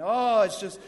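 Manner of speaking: slow, drawn-out mock complaint; hammed-up weary whining; mock discouraged. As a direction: moan it like a put-upon worker. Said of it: "they look like they're just, somebody's licked the stripes".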